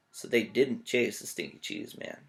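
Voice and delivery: plain reading voice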